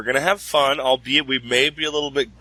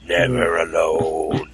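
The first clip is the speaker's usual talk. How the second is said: ominous spooky voice; 7 semitones lower than usual. Pretend. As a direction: whisper it like a horror tagline. Spooky echo of "never alone.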